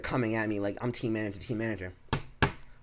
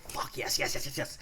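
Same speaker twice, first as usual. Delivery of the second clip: whispered celebration; 3 semitones higher, 4 dB quieter than usual. Retold or imitated. Celebrating the store closing.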